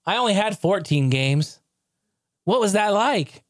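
high, slow, mocking whine; a hammed-up high sarcastic sulk; sarcastic Virtual Boy. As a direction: stretch it out high with bitter sarcasm. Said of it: "Virtual Boy's like".

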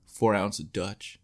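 lightly voiced smuggler answering; slow deadpan mock answer. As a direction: drag it out like a nervous smuggler. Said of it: "you know, like".

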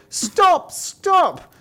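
very high, loud cry to stop; high-pitched shout. Acted. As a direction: call out shrilly to halt the cart. Pin and needle cry out.

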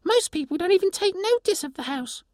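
tiny creature asking in wonder; pixie voice. Acted. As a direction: pixie asking the stranger if rain melts her. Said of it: "the sprite said".

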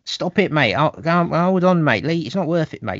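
hammed-up bloke in a pub scrap saying leave it; mock lad begging his pal to drop it; mate holding back a friend. Acted pub mates holding back.

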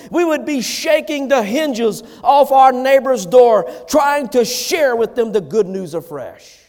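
impassioned preaching. Real, not acted. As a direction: urge the church on, punching the key words.

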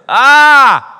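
sudden high, loud pained cry of "ah"; pained outcry. Real, not acted. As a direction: cry out as if stung by the verse. Reacting to the verse on anger.

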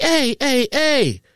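high-pitched, urgent heys without shouting; retold protest. Father retelling how he held off the men, protesting. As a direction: fend people off with high, quick protests.

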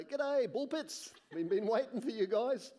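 high, hammed-up hearty welcome; mock royal greeting. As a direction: call out a comic greeting to arriving visitors. Imagined royal airport greeting.

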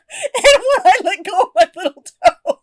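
laughing through a painful foot cramp. Real, not acted. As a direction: laugh and wince, toe cramping. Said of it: "I have a foot cramp".

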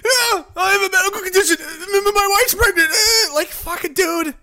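very high, whimpering mock sob; whiny crybaby voice. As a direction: squeal it like a crybaby. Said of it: "He gets tased".

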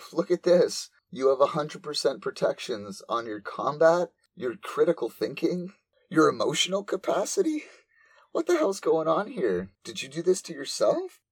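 alarmed discovery. Retold or imitated.

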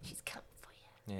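eerie breathy whisper, no voice; horror trailer whisper. Acted. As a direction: hiss it like a creepy tagline. Spooky teasing threat.

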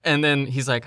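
usual speaking voice

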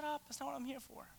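soft, curt brush-off; dry put-down. Retold or imitated. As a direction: shut them down quietly. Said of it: "Jesus is like".

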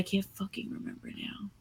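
breathy whispered aside. Real, not acted. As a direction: whisper it under your breath. Forgot point, mutters to self.

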